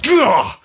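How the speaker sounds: annoyed high grunt of frustration; exasperated groan. Acted. Speaker cut off by the door, groaning in annoyance. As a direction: groan at the interruption.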